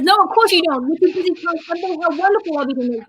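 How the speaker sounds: fast, tumbling line reading; rushed delivery. Acted. Retelling Elphaba's retort to a friend, fast. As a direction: race through the line. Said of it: "I'm doing very fast".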